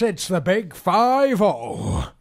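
slow, loud announcement; dragged out. Acted. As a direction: stretch every word grandly. Mock announcement of birthday.

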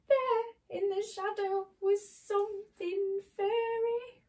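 high, tense storyteller build-up; fearful suspense. A narrator's reading. Suspense before the cat scare.

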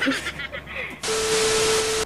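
soft airy giggle after a joke; breathy giggle. Real, not acted. Vlogger giggling softly after teasing a hater back. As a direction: let a quiet breathy laugh slip out.

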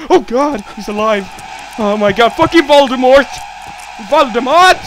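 loud, high-pitched alarm; alarmed shouting. Real, not acted. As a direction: cry out as the enemy comes back to life. Monster is alive again.

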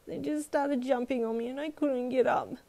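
put-on high, tearful little girl's voice; sobbing child. A narrator's reading. Girl telling mum about the dogs, acted sobbing. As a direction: pour it out tearfully, pitched high. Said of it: "you sobbed".

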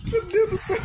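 high, breathless laughing relief; shaken laughter. Real, not acted. Recovering from jump scare.

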